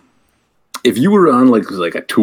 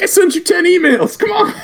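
squeaky high pleading whine; mock whiny plea. Friend mocks Jordan begging to be let in, high whiny plea. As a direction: whine like a kid locked out. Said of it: "He's like".

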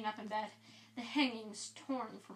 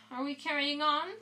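bright; 4 semitones higher, 6 dB louder than usual. Acted. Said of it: "said Fred Weasley brightly".

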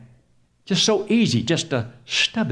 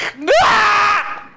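sudden high, loud yelp of pain; hammed-up agonized yell; mock pain scream; 7 dB louder than usual. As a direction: scream as if you hit your thumb. Pretends hammer hit his thumb.

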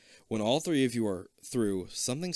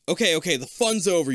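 put-on bright, teasing kid voice; lightly voiced hero; playful declaring; 6 semitones higher, 7 dB louder than usual. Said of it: "you declare".

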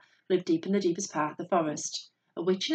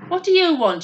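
high, loud irritated demand; sharp, raised challenge; snapping; 11 semitones higher, 9 dB louder than usual. A narrator's reading. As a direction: snap at an unwelcome visitor. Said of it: "she snapped".

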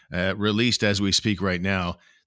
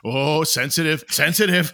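mocking taunt; 6 semitones higher, 4 dB louder than usual. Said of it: "you guys are like".

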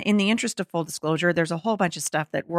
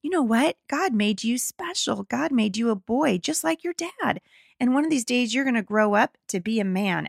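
higher, tender parent voice; retold gentle talk to a little son; gentle, loving; 4 semitones higher than usual. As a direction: reassure a small child lovingly. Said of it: "we just gently and lovingly said".

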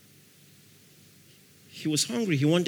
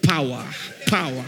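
hammed-up preacher; 3 semitones lower than usual. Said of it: "hitting the mic".

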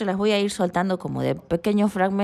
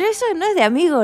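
high, fast, louder protest; hammed-up outrage at a friend; mock indignant; 10 semitones higher, 5 dB louder, faster than usual. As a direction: snap back in mock offence. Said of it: "le decía".

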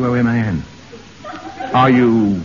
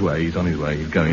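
mock fading; 11 semitones lower, 6 dB quieter than usual. Mock deathbed fuss over Sid.

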